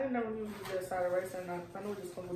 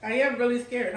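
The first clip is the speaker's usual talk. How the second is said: half-joking, loud and nervous; loud nervous whine; 3 semitones higher, 13 dB louder than usual. Real, not acted. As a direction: play up being scared of the food. Nervous about trying new food.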